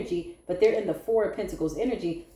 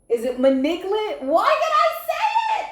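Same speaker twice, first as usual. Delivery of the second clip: much higher and louder, squealing with laughter; laughing squeal; 12 semitones higher, 7 dB louder than usual. Real, not acted. Reader losing it over one word, squealing. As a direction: squeal through the giggles. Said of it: "I'm laughing because".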